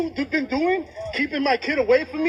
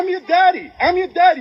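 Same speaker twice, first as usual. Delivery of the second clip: raised voice, pleading; pleading shout; 5 semitones higher, 5 dB louder than usual. Real, not acted. Father pleading loudly with child.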